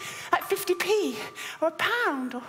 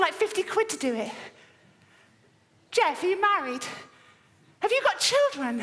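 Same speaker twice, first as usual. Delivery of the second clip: ordinary delivery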